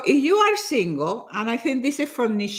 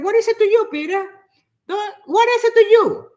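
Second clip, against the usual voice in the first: high, louder acted rebuke; rebuking; 10 semitones higher, 5 dB louder than usual. Speaker quoting Jesus to gossiping Peter, rebuking. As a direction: challenge him to mind his business.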